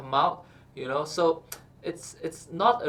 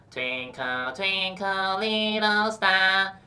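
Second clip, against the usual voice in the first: louder, higher, nasal twangy singing; 5 semitones higher, 4 dB louder, much slower than usual. Shown as a demonstration. Coach demos nasal twangy singing, irritating twang. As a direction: sing it twangy and through the nose. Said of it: "nasal and twangy together".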